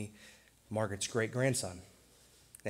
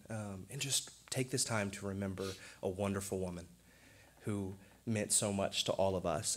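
usual speaking voice

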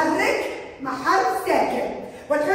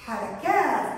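ordinary talk, nothing marked

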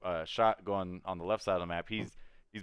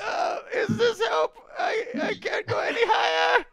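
extremely high, straining goblin squeal; even higher voice; 6 dB louder than usual. Acted. Goblin straining to please players asking for higher, piercing squeak. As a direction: push the goblin squeak to its loud upper limit. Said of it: "maybe like a higher voice".

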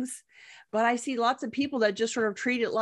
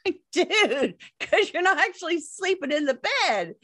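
high, amused disbelief; mock-exasperated "dude", pitched high; retold exasperation; 9 semitones higher than usual. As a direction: lightly scold a clueless client.

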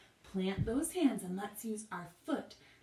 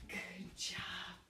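whispering; 3 semitones lower, 8 dB quieter than usual. Real, not acted. Whispered praise.

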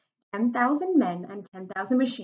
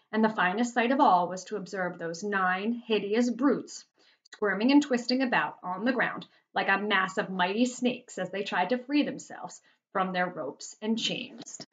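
plain reading voice

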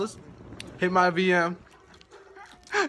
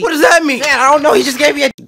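alarmed loud; 7 semitones higher, 8 dB louder, faster than usual. Acted.

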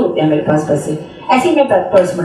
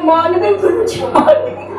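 laughing in awe; 8 semitones higher than usual. Real, not acted. She marvels at guruji.

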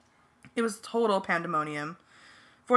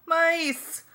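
high, loud shriek of alarm; sudden squealed shout; alarmed yell; 10 semitones higher, 4 dB louder than usual. A narrator's reading. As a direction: yell a high-pitched warning. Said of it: "Tracy yelled".

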